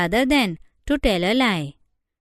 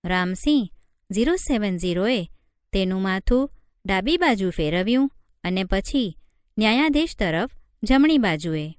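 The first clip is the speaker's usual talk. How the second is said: ordinary delivery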